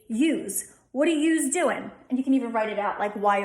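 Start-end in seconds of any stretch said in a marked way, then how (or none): 0.1-0.7 Philly "use." said with a long u and a z at the end; "use." said as yooz, the Philly way; Philly yous. Shown as a demonstration. Teacher says "use." for the class in a Philly way. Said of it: "a long U and Z". 0.9-1.9 Philly "yous" said as yooz; casual Philly "yous" question; Philly accent. Shown as a demonstration. Teacher gives a Philly example line in a Philly accent. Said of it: "So in Philly, it's gonna be use".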